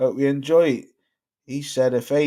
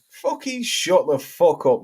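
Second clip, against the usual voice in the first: ordinary talk, nothing marked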